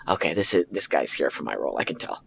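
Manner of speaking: dropping low and soft, almost to himself; muttered dread under the breath; quiet inner voice. Retold or imitated. Actor realizing rival is here for his role, quietly sinking.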